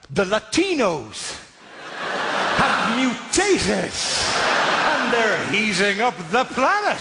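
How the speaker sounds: slow, grave announcement rising to a shout; a scientist proclaiming apocalypse, hammed-up; mock dramatic scientist. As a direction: deliver the revelation like a doom warning. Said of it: "this bloke had just gone".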